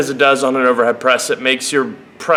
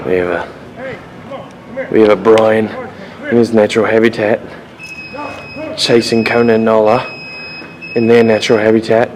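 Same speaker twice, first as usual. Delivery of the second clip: hushed, lower wildlife narrator voice; nature documentary; 4 semitones lower than usual. Pretend. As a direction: narrate him like a rare animal. Mock wildlife narration of Brian.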